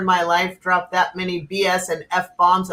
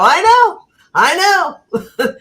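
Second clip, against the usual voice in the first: imitating a high, loud emphatic I know; exasperated agreement; 10 semitones higher, 5 dB louder than usual. Retold reply to the client's bomb fear, high and loud. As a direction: throw up your hands vocally, much higher.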